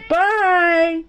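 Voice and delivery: high, drawn-out call; calling goodbye. Acted. Crowd waves off the truck.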